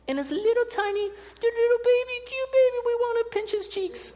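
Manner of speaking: put-on high sing-song baby talk; cooing baby talk. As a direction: coo it out as if pinching a baby's cheeks. Cooing over a cute baby.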